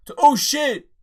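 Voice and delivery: sudden high, loud "oh shit"; startled yelp of surprise; shocked outburst. Retold or imitated. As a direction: jolt up as if caught off guard. Sudden shocked reaction while reading.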